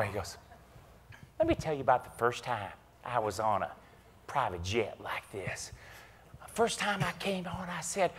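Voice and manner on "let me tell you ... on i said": Chambers imitation. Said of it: "So there's the Chambers imitation".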